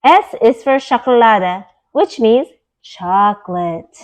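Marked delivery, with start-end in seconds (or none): none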